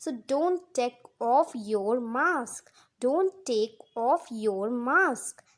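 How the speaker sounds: slow, careful teacher read of each word; slow model sentence. Shown as a demonstration. Teacher reading an example to Hindi learners, slow and clear. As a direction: say it slowly so students can repeat. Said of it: "teacher models example slowly".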